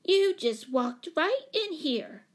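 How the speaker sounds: put-on loud, slow, high child's accusation; little girl protests loudly. A narrator's reading. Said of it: "in a loud voice".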